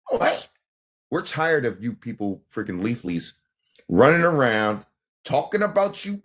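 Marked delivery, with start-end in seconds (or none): 0.1-0.5 a sudden squeaky high What?; a jolt of disbelief, pitched way up; shocked what. Real, not acted. Shocked reaction to a claim.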